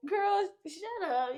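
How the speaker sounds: high, drawn-out mock dismissal; playful brush-off. Brushing off a girlfriend's threat at a party, playfully.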